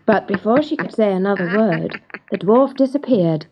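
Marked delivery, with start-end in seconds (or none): none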